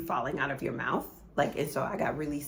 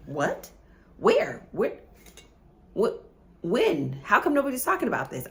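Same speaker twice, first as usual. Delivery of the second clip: high, loud, incredulous questions fired one by one; retold shock, pitched up and loud; acted disbelief; 6 semitones higher, 5 dB louder than usual. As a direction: replay your stunned outburst, speeding up at the end. Acts out her shocked reaction to nurse.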